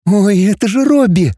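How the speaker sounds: light up with glad recognition; delighted. A narrator's reading. Girl spots an old robot she knows, delighted. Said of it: "обрадовалась девушка".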